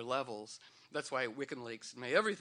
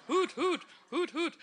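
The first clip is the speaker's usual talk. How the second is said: high, loud owl hooting; owl hoots; 13 semitones higher, 6 dB louder than usual. Pretend. Mock owl call for her Owl Jazeera news, high and hooting. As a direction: hoot like a cheerful owl.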